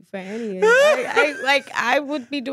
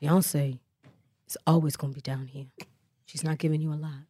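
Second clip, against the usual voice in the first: low, soft [name] voice; [name] impression; 6 semitones lower, 7 dB quieter than usual. Asked what [name] sounds like, low and soft impression. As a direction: drop down low and hush it like [name]. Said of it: "What's [name] sound like?".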